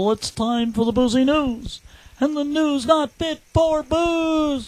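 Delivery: drawn-out mock announcer fanfare; segment announcer. Host introducing the boozy news segment to listeners, hammed-up sing-song announcer.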